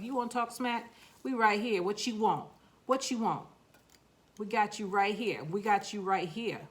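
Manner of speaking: voicing the seeds with a cocky New York accent; tough-guy New York street talk, taunting. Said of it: "Talking in my New York accent".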